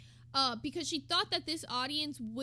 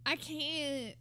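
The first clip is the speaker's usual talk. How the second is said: ordinary talk, nothing marked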